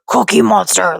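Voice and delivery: scratchy growly monster voice; Cookie Monster. Shown as a demonstration. Mother showing her story-time voices, scratchy Cookie Monster impression. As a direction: growl the name like a furry monster. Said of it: "and Cookie, like".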